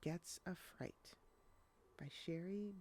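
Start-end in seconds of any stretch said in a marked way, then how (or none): none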